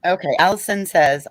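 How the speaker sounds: ordinary talk, nothing marked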